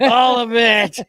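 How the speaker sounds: high, loud hammed-up whoop; tipsy glee. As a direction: belt out the punchline like a happy drunk. Tipsy punchline about the whiskey.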